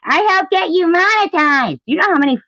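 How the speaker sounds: shrill mocking impression; mocking Sharon. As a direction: parody her smug claim. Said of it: "so that she could say".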